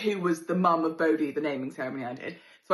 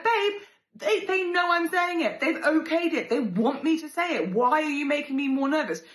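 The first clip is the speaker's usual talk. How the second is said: higher, louder pleading protest; exasperated plea; 10 semitones higher, 4 dB louder than usual. Retold or imitated. Pleading with her husband to stop, high and exasperated. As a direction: argue it up high and rattled.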